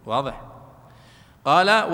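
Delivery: ordinary talk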